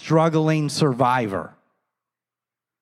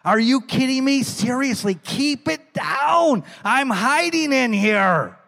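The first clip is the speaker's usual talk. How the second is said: higher, hushed panicky plea; panicked Gideon; 6 semitones higher than usual. Retold or imitated. Gideon pleading with the angel to hush, panicky. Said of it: "I'm thinking Gideon's like".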